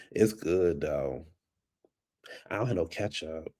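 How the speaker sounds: ordinary talk, nothing marked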